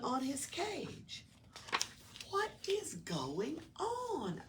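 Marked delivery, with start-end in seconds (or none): 2.3-4.4 high, slow puzzled lizard voice; slow it down, high and wondering; Oscar wondering aloud. A narrator's reading. Lizard puzzling over the decorations, high and slow. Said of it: "Oscar wondered".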